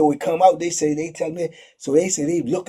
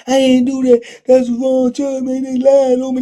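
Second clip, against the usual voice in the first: higher, pleading denial; protesting accused; 8 semitones higher, 4 dB louder than usual. Retold or imitated. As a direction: plead innocence to the inmates. Accused man protests innocence.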